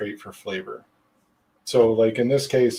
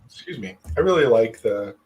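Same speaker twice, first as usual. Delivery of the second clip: ordinary talk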